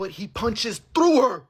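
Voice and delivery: slow, high, loud villain narration voice; ominous slow-motion announcer of doom; Nolan. Acted. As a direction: drag it out slow and heavy like a grim superhero. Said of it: "I'm talking like Nolan in The Immortals".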